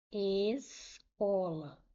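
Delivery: Escola drawn out very slowly; slow syllabified demo. Shown as a demonstration. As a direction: stretch Escola out, letting S and C each sound. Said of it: "você não fala escola".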